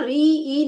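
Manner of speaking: "री ई" slow, the ee held on; drawn-out ee sound. Shown as a demonstration. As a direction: stretch "री ई" into a long high ee. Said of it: "उच्चारण करेंगे तो ई ही निकलेगा".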